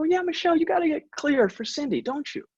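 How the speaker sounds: high, hammed-up voice of his oblivious self; squeaky mock self-parody; mock clueless boss. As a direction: play yourself as the cheerfully clueless doctor. Mocking his own clueless self.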